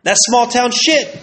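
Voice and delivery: That's small town shit, loud, high and slow; exclaimed verdict. Real, not acted. Wrapping up the ex story, loud high exclamation. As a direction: belt out small town like a punchline.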